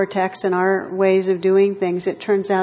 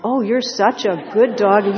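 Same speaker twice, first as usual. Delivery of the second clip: louder, gushing praise for a pet; gushing dog praise; 5 dB louder than usual. Retold or imitated. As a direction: lay the praise on thick for the dog. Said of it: "listening to this voice going".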